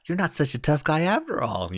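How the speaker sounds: high, creepy hillbilly taunt; hillbilly dude. Retold or imitated. Swamp hillbilly taunting his victim, high hillbilly voice. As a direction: put on a high-pitched hillbilly voice. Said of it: "hillbilly dude in your face".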